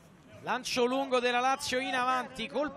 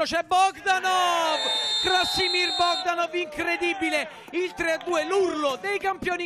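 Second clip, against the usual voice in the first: breathless italian goal commentary; 4 semitones higher than usual. Real, not acted. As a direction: shout the scorer's name, stretched. Winning goal live call.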